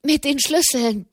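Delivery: high, tumbling and eager; excited. A narrator's reading. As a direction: rush it out with joy. Breathless prelate reports news.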